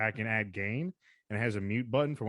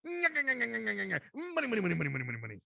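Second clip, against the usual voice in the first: greedy crab chanting money; Mister Krabs voice; 8 semitones higher, much faster than usual. Pretend. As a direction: chant it like a greedy sea captain.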